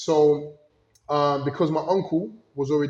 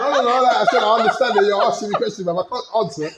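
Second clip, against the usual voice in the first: laughing protest; 9 semitones higher, 9 dB louder than usual. Real, not acted. Laughing protest about understanding Spanish.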